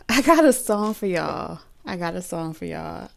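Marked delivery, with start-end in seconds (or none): 0.1-1.6 higher, louder, laugh in the voice. Real, not acted. Reader telling viewers she has a song, laughing. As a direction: announce it through amusement. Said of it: "I'm laughing because".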